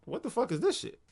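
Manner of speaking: high-pitched, mildly incredulous question; acting out baffled reaction to the mail; retold disbelief. Retold reaction to tax bill.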